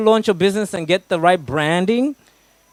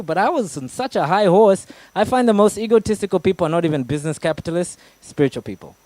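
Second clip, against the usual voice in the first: usual speaking voice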